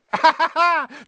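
loud, high poltergeist cackle; gleeful cackle. Acted. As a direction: cackle with glee. Peeves cackling.

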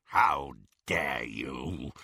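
low, slow werewolf snarl. Acted. Greyback to Bellatrix, low slow snarl. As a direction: growl it like a trapped beast. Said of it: "he snarled".

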